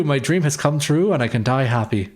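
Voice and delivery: normal talking voice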